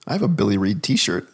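ordinary talk

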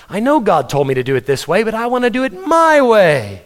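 mock stubborn insistence, rising as it goes; self-willed defiance. Self-willed rebel answering back to God, mock defiant. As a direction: build to a high, insistent MY WAY.

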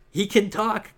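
high, loud astonished cry; gasping surprise. A narrator's reading. Clark gasping when the monster speaks, astonished. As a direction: gasp it out in disbelief. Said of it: "Clark gasped".

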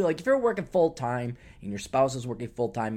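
usual speaking voice

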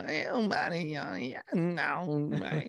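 slow, soft rapper drawl imitation; [name] voice. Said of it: "I can't do the [name] voice".

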